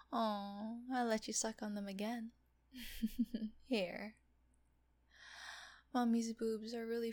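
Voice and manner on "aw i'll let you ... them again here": ordinary talk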